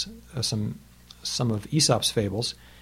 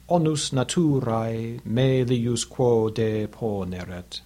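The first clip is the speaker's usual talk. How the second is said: slow, measured Latin recitation; Latin poem reading; slower than usual. Shown as a demonstration. Teacher reads Phaedrus's fable in Latin to the class. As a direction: recite the verse slowly with its meter. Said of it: "I'll read it for you now in Latin".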